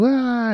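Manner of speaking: high-pitched imitated scream at ordinary volume; mock scream. Retelling the patient's scream from the movie, high mock scream. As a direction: let out a token high "wah" scream. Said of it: "She screams. She goes".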